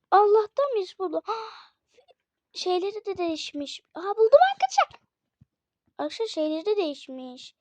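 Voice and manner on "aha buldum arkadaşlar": high, loud, fast cry of triumph; excited find. Real, not acted. Gamer telling viewers she found it, excited shout. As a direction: blurt it out, thrilled to have found it. Finds hidden item in game.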